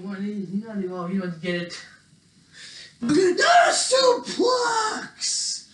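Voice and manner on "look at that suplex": slow, high-pitched commentator's cry; wrestling announcer shouting over a big throw; amazed play-by-play. Pretend. Mock wrestling commentary, suplex.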